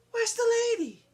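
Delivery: high, worried cry into the distance; anxious call. Acted. Lost dog cries out for the lady, high and worried. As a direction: call out for her, scared and lost.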